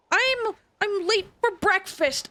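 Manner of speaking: usual speaking voice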